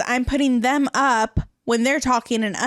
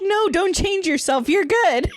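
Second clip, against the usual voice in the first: higher-pitched, laughing imitation of eager reassurance; mock-gushing, pitched up; 7 semitones higher than usual. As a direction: playfully overdo the encouragement. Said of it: "'cause she was very".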